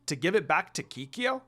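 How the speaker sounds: ordinary delivery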